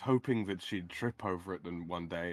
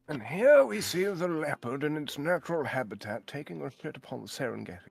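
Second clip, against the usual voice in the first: David Attenborough; 6 semitones higher, 5 dB louder than usual. Retold or imitated. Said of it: "nothing but David Attenborough nature documentaries".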